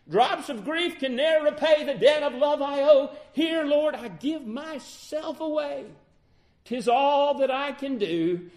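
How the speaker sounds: high, loud, ringing declaration; impassioned hymn recitation. Real, not acted. Preacher offering himself to the Lord, impassioned recitation. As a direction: cry the hymn out at full preaching force.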